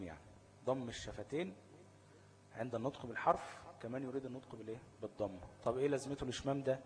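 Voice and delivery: normal talking voice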